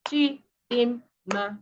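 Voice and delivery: Chi-din-ma, drawn out slowly with a gap between each syllable. Shown as a demonstration. Teacher counting the syllables in a name, slow and separated. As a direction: break Chidinma into three separate beats. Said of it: "what we call the syllable".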